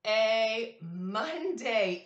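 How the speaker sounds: slow and loud, stretched out; drawn-out answer. Shown as a demonstration. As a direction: draw out the answer for a child. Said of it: "teacher reveals answer slowly".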